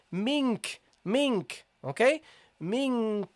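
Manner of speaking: mink, with the kaf pressed at its point of articulation; slow model of mink, kaf held clearly at the back; teacher models mink, tongue on the kaf point; Quranic kaf demo. Shown as a demonstration. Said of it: "I pronounce the kaf, okay, by purpose, so to show you".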